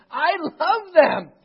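high, very loud, slow declaration; impassioned admonishment. Acted. Jesus correcting his disciples about the lost, impassioned. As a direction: proclaim it with full force, stretching the words. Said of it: "He admonishes them".

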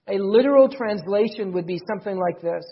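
ordinary talk

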